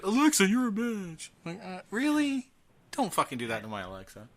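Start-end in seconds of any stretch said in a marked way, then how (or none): none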